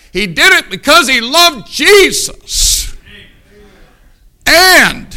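high, loud and slow, rising to a laugh; shouted praise breaking into laughter; preaching shout. Real, not acted. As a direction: lift the line to a joyful peak.

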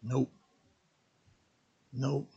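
very soft, low, short answer; glum reply. Acted. Fishermen answering the stranger on the shore, quiet and glum. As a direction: say it low and barely audible.

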